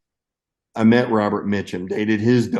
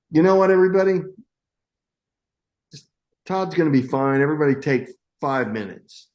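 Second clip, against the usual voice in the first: imitated calling out; 6 semitones higher, faster than usual. Star calls out to whole crew.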